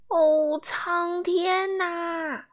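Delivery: drawn out very slowly, aghast; shocked exclaim. A narrator's reading. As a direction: gasp it out, horrified at the mess. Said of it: "忍不住叫道". (拉长声音、惊骇地叫道)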